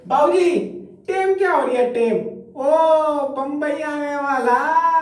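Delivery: high-pitched call down the carriage; passenger calling out. Acted. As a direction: call out high, stretching the end. (ऊँची आवाज़ में यात्री की पुकार)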